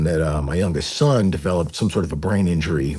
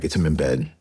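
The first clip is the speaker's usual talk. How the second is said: normal talking voice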